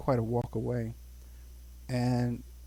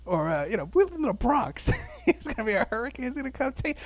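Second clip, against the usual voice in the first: mother quoted; 9 semitones higher, 4 dB louder than usual. Retold or imitated. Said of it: "And she goes".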